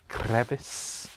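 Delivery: Crevice in a put-on accent, drawn out and rising. Shown as a demonstration. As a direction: repeat "Crevice" in a playful accent with lifted ending. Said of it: "say it with that accent".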